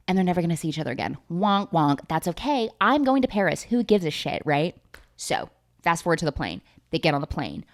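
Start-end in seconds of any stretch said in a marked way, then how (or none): none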